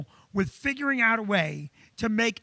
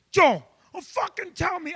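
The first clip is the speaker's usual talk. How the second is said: high, shouted angry outburst; furious boss rant; 7 semitones higher than usual. Retold or imitated. Boss exploding at his sign guy in a meeting, shouting high. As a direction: explode at the employee, pitched high. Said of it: "and he goes".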